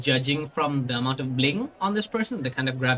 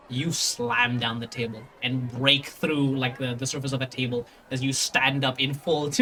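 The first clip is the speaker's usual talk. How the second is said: ordinary delivery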